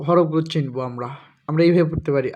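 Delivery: ordinary talk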